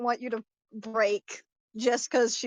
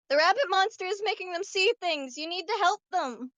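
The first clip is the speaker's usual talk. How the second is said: put-on high-pitched child's plea; small, high kid voice; little child; 6 semitones higher than usual. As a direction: pipe up as a small child urging help. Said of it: "you see this little child".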